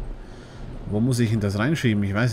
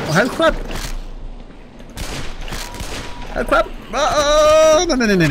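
high, loud cry of alarm; alarmed shout; 3 dB louder than usual. Real, not acted. Gamer attacked by a headcrab, high alarmed cry. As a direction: yell as the creature leaps at you.

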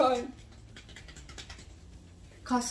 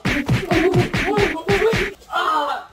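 loud, high yelled "oh"; shouted cries; 8 semitones higher, 10 dB louder than usual. Real, not acted. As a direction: yell out in alarm, high and loud. Wordless cries during a mock fight.

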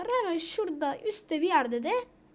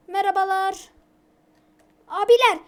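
much louder and higher, yelling at the screen; frustrated shout; 5 semitones higher, 15 dB louder than usual. Real, not acted. Gamer yelling at a stuck loading screen, loud frustrated shout. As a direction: bellow at the game in disbelief.

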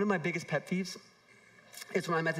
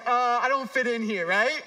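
mock-anxious, a bit higher and louder; self-mocking jitters, half-hearted; nervous newcomer; 4 semitones higher, 3 dB louder than usual. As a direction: play your own nervous first day at the gym. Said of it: "I'm just like".

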